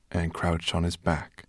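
usual speaking voice

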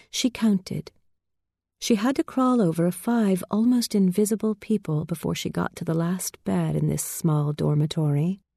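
ordinary delivery, nothing marked

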